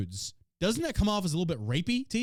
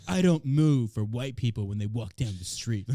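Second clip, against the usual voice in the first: lightly voiced mock-serious activist; a hint of solemn self-importance; mocking TikToker again; 6 semitones lower, 3 dB louder than usual. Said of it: "He's like".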